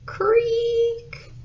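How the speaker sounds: very high, long squeaky creak; voiced imitation of a high creak; creaking floor. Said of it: "His floor went".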